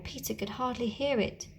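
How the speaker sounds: plain reading voice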